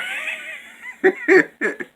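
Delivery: big high burst of laughter; loud laugh. Real, not acted. Vlogger cracking up at her own joke, laughing loudly.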